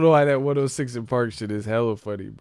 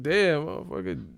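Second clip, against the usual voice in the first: ordinary talk, nothing marked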